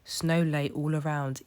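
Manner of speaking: usual speaking voice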